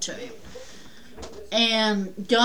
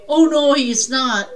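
mock angry refusal; 4 semitones higher, 7 dB louder than usual. Father refusing, quarrel.

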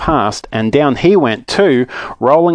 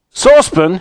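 loud, higher call of recognition; yell; 8 semitones higher, 5 dB louder than usual. Acted. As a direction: yell a friend's name in surprise. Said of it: "he yelled".

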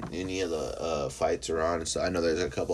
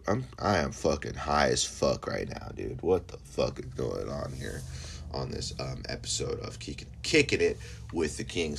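ordinary talk

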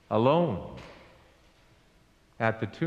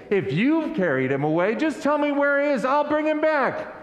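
exasperated plea; 8 semitones higher than usual. Acted. Mary's exasperated plea, voiced by preacher.

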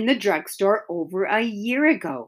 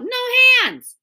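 yelled; 13 semitones higher, 5 dB louder than usual. Acted. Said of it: "he yelled".

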